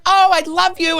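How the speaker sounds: high-pitched imitation of an adoring fan; mock fan squeal; gushing fan. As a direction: gush it up high.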